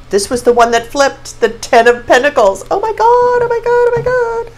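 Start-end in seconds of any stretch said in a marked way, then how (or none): 2.7-4.5 high, squealing oh my God; excited squeal. Real, not acted. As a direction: squeal with delight over the card. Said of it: "I'm getting a little excited here".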